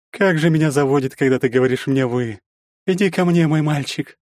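high, teasing seductive voice; flirty stepmother. A narrator's reading. Anfisa seduces.

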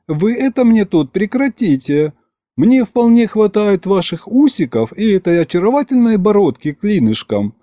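high-pitched feminine scolding; scolding Masha. Acted. Masha telling Charles to stop this, scolding. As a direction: chide in a high, fussy voice. Маша отчитывает посла.

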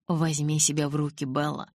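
usual speaking voice

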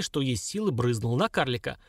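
ordinary delivery, nothing marked

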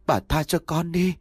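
high-pitched cry for mercy, restrained; wailing plea. A narrator's reading. Beaten maid begs her mistress to stop, crying out. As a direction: plead in a high, mildly wailing voice. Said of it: "Con Mận la hét oái oái".

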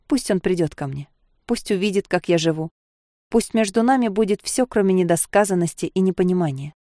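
plain reading voice

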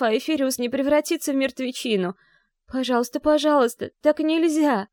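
high, pleading through tears; choking on tears. Acted. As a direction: beg in desperate sobbing disbelief. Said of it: "захлёбываясь слезами".

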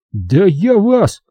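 slow, high sputtering threat; threatening officer. Acted. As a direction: start a threat then get cut off. Staff chief starts threatening.

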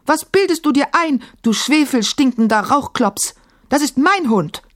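furious, defending her dog; indignant girl. A narrator's reading. Emma defies the djinn.

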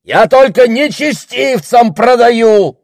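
high, slow, indignant shout; loud outcry. A narrator's reading. Pig seller justifying his trade to the crowd, shouting. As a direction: yell the excuse to everyone. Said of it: "громко выкрикнул он".

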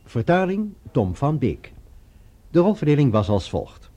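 ordinary delivery

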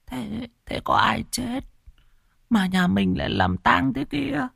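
put-on old woman wondering about the funeral; puzzled grandmother. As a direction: ask like a confused old lady. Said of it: "Bà cụ thắc mắc".

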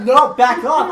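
loud, startled push-back; mock-panicked yell; retold protest. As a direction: shout it like fending someone off. Retold fending off in nightmare.

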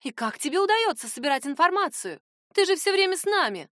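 rising excited admiration; admiring. A narrator's reading. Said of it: "восхитилась Райера".

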